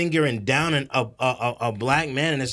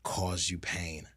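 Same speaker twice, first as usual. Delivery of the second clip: a gently acted remorse; soft apology; 9 semitones lower, 10 dB quieter than usual. Imitating a sincere apology.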